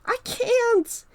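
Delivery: high, loud whining Carl voice; yell. Acted. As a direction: wail it while running behind. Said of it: "he yells".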